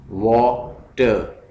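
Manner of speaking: Water very slowly as वा, pause, टर; split into syllables. Shown as a demonstration. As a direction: stretch water into two separated beats. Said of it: "इसके syllables हैं".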